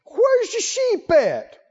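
high, scornful big-brother put-down, lightly laughing; mock scolding. David's brothers scold him for talking big, with a mock sneer. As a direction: sneer at him like an annoyed older brother, half joking. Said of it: "David's brothers came over and said".

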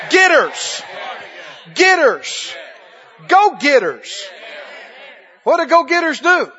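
loud, high-energy exhortation; full-force preaching. Real, not acted. Preacher urging go-getters in the congregation, loud rousing preaching. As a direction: rouse the congregation, punching each phrase.

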